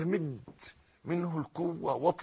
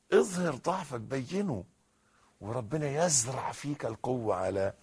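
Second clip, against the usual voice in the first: usual speaking voice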